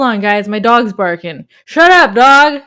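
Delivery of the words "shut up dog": 1 mock scolding. Scolding the pretend dog.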